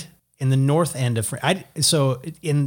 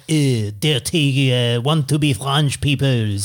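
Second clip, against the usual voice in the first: slow mock French sneer; slower than usual. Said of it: "they're like".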